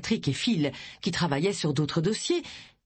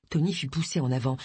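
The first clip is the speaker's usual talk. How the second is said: usual speaking voice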